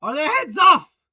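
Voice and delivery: very loud, high demand; shouted question. Acted. Queen checking on her soldiers, shouting. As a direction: bellow the question imperiously. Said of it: "shouted the Queen".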